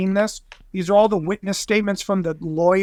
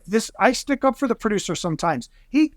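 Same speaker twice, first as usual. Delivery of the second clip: ordinary delivery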